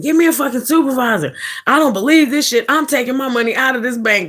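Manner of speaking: high, fast cussing at the rep; angry fussing. Retold or imitated. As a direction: storm at the bank.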